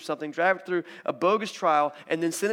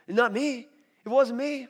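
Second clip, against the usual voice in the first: scared denial; 7 semitones higher, 3 dB louder than usual. Acted. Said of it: "He's scared in the moment".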